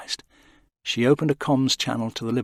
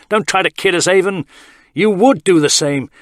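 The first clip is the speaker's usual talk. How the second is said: higher, loud and biting; angry accusation; 9 semitones higher, 10 dB louder than usual. A narrator's reading. Tarrant accusing Avon, angry. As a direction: go for his throat.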